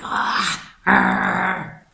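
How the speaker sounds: breathy mock groan of frustration; hammed-up groan at the pressure; frustrated groan. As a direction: let out an exasperated argh. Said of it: "And you're like".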